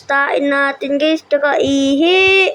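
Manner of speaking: ordinary talk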